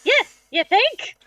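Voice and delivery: high, drawn-out sarcasm; sarcastic retort. Acted. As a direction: stretch it into an incredulous question. Retort to Zeltron.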